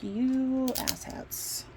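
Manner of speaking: very slow, drawn-out insult; scolding the dogs for the muddy sheets; fond scold. Retold or imitated. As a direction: drag it out at the dogs.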